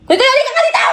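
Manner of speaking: high, loud laughing call to come over; laughing shout. Real, not acted. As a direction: yell for him to come sit, laughing. Calling partner over, laughing.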